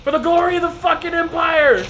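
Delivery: loud high war cry; battle cry. Acted. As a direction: charge like a soldier.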